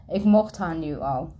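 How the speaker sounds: plain reading voice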